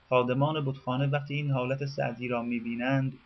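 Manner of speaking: ordinary delivery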